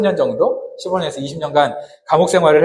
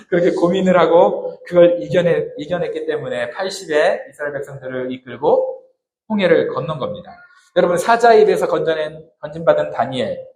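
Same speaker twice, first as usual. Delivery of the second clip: normal talking voice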